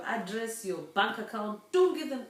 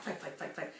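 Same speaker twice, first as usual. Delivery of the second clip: ordinary talk, nothing marked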